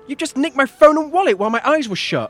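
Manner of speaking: high, loud angry outburst; outraged shout. Acted. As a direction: shout in shock at the theft. Student finds phone and wallet stolen.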